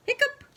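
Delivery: high squeaky rising hiccup; hammed-up hiccup. Shown as a demonstration. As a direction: try it as a high cartoon hiccup. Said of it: "I don't know how best to say that".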